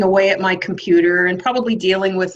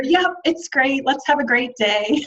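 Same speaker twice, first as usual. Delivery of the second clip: higher, put-on upbeat greeting; fake cheer; 5 semitones higher than usual. Employee telling coworkers what they want to hear, fake cheer. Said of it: "I was being fake".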